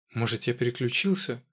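ordinary delivery

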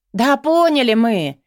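loud, high, drawn-out protest; exasperated interruption. A narrator's reading. Лотта cuts off the rector's lecture, loudly exasperated. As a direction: cut in loudly, stretching the words. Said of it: "перебила Лотта ректора".

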